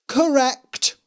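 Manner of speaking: high, loud, cold queen; haughty queen. A narrator's reading. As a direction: snap it like a vain queen. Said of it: "said the Queen".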